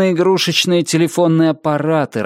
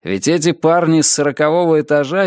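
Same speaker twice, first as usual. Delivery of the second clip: plain reading voice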